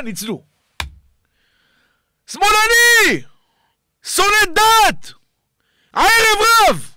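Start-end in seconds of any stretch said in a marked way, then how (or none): 2.3-6.9 high, drawn-out heckling cry; raised-pitch shouted jeer; name-calling shout. Acted. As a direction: call out each label like an accusation. Mock name-calling shouts to the crowd.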